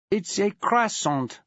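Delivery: slow, lightly put-on French accent; a hint of French, dragged out. Auxiliary to Maxwell, in a light French accent. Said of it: "she gave it her best French accent".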